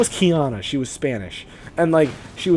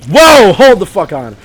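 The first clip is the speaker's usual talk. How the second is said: loud, startled whoa, then hold on; sudden stop; 3 semitones higher, 11 dB louder, slower than usual. Real, not acted. Vlogger stopping himself before a bad idea, loud and startled. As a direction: catch yourself before you say it.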